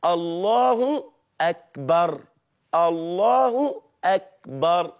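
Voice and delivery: الله أكبر slowly, "Allahu; correct takbir. Shown as a demonstration. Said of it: "تقولها كده".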